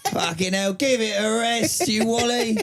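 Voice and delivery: high, drawn-out jeering complaint; mock irritated Londoner. Londoner scolding a noisy screamer, hammed-up high complaint.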